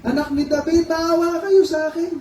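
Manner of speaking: high-pitched pleading call of a blind beggar; acted high cry for pity; crying out for mercy. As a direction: call out desperately from the roadside. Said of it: "Sumigaw siya, sabi niya".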